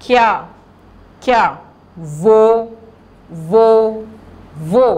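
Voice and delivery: measured teacher dictation, pauses between words; word-by-word reading drill. Shown as a demonstration. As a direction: model each word for pupils to repeat. Models words for pupils to repeat.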